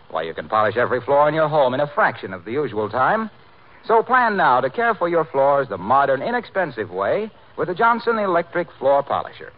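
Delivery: usual speaking voice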